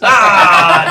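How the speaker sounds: sudden yelled "ah"; loud outburst. Real, not acted. Game master reacts to the saving throw, loud yell. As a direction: burst out with a big cry. Reacting to the plant's failed save.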